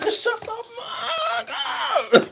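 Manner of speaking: very high, slow, exaggerated; mock fed-up retort. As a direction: ham up telling them to shut up. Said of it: "I'm like".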